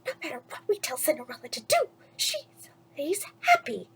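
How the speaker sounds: conspiring so nobody hears; secretive whisper. Acted. Anastasia pulls sister aside secretly.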